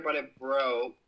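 normal talking voice

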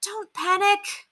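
high, drawn-out call; crying out. A narrator's reading. Governess calming the screamers, crying out. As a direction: call it out slowly to stop the panic. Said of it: "Penelope cried".

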